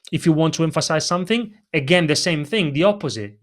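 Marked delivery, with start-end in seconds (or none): 0.1-1.5 quick, rushed delivery; faster. Shown as a demonstration. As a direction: speed through the phrase. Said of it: "You can go a little bit faster".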